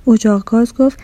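ordinary delivery

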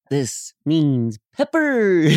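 "This means pepper" slow and drawn out, falling on "pepper"; slow falling "This means pepper" cadence. Shown as a demonstration. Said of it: "to the same cadence as".

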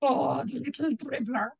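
mock shout after a fleeing man; shouting after him. Big woman chasing her little husband from the cottage, mock shouting. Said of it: "shouting after him".

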